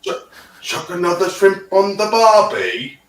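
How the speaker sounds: Australian mocking foreigners' Cockney British accent. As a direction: ham up a Cockney British barbie line. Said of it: "Cockney British accents".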